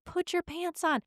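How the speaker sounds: high-pitched mock yell, no real volume; imitating screaming mom. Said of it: "my mom just keeps going".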